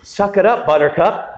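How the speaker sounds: lightly voiced five-year-old needling the lady beside him; bratty kid. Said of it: "He goes".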